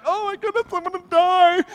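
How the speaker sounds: high-pitched melodramatic wail; hammed-up, high suffering cry; mock agony. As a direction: whine it as if starving to death. Acting out misery while fasting.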